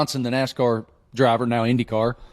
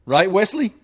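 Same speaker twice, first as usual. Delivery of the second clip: voice cracking; 6 semitones higher than usual. Real, not acted. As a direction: voice cracks upward on the question. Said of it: "did my voice just crack like I was sixteen?".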